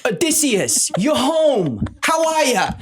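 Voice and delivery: high, hammed-up Queens holler; New York Queens accent. Actor slipping on the Odyssey, full Queens accent. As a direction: greet them in broad New York Queens. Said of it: "full, like, New York Queens".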